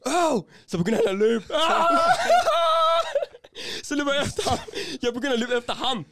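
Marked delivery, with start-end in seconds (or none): 0.1-0.4 loud high mock yell. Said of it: "Så begynder jeg at råbe". 1.5-3.8 very high mock scream into laughter; imitating the fleeing boy's scream; scared scream.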